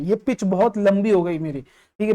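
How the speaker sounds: usual speaking voice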